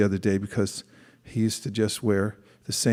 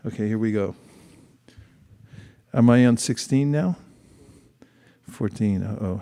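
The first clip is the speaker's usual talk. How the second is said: ordinary delivery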